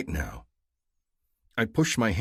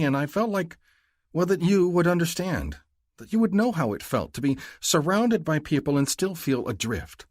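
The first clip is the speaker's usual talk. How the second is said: higher, louder female voice, earnest; Mahdi confiding; 8 semitones higher, 4 dB louder than usual. A narrator's reading. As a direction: confess it as the heroine. Narrator voices Mahdi's confession.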